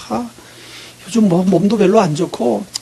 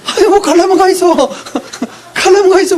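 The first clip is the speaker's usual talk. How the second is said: much higher, laughing mock dismissal; laughing scoff; 9 semitones higher than usual. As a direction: toss it off with a laugh, go if you want. Mock reaction to pastor quitting. (시골 간다는 목사에게 웃으며 비꼬듯, 높은 톤으로)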